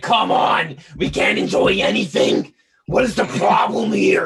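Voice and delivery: loud mock exasperation, rasping; imitating her fed-up shout; exasperated shout. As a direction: yell it like she's fed up with everything. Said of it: "she's like".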